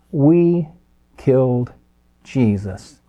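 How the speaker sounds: each word set apart by pauses, falling on Jesus; "We ... killed ... Jesus" slow and deliberate; emphatic. Acted. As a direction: drive each word home from the pulpit.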